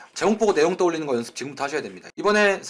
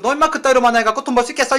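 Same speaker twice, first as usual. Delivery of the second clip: mock scolding boss; 5 semitones higher, 4 dB louder, faster than usual. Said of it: "이 지랄하고 앉아있는 거고".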